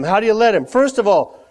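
normal talking voice

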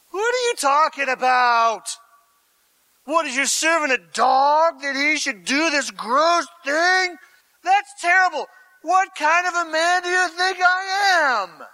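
loud, high-pitched affronted protest; indignant outburst. Acted. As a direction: push it up high, appalled at the accusation. Hazael's indignant retort acted out.

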